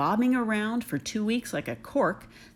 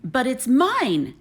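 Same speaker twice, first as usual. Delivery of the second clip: louder, slow possessive scream; 4 semitones higher, 6 dB louder, slower than usual. Acted. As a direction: drag each word out, claiming the egg. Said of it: "screamed the bird".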